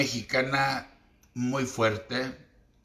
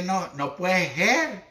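imitating el Peje; 4 semitones higher than usual. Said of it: "como dijo el peje".

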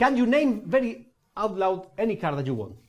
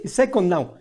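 usual speaking voice

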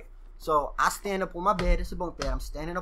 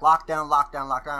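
loud, repeated lockdown order; deputy calling lockdown; 8 dB louder than usual. Retold or imitated. Deputy orders the pod to lock down, loud. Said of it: "So he's like".

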